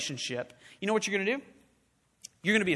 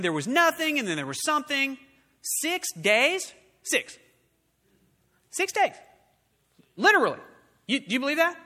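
higher, incredulous and pressing; incredulous challenge; 5 semitones higher, faster than usual. Acted. Preacher challenges the congregation's belief, higher and incredulous. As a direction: push them on whether they believe.

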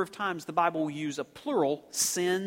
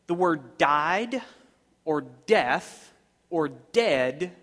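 slow, louder words stretched out; slow emphatic preaching; 4 dB louder, slower than usual. Acted. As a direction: lean hard on each phrase.

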